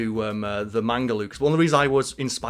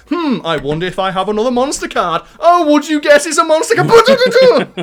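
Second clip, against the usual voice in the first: mock wondering building to high, loud glee; taking the piss; 13 semitones higher, 11 dB louder than usual. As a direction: feign surprise, hamming up the dub actor. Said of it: "talking as if they're taking the piss".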